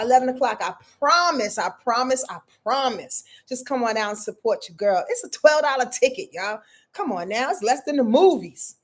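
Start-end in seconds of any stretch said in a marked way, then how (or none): none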